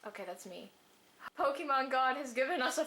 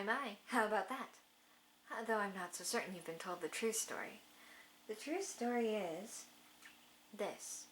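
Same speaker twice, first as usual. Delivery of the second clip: quiet, lower character voice; 4 semitones lower, 6 dB quieter than usual. Acted. Reads Volo's dialogue.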